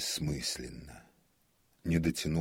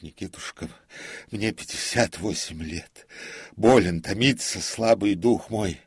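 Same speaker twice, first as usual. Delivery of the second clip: put-on higher, emotional old man's outpouring; tearful lament; 4 semitones higher, 8 dB louder than usual. A narrator's reading. Old comic laments his lonely benefit night, anguished. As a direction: pour out your woes, voice rising. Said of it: "бормотал обессилевший Колхаз".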